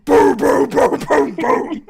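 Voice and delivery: loud imitated stomping thuds; pounding vocal sound effect of running; heavy footstep booms. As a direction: boom out each heavy Minotaur footfall. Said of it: "He's not a light stepper".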